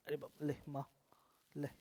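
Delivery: a hushed, breathless aside; whisper. Real, not acted. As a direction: drop to a whisper of surprise. (फुसफुसाकर हैरानी)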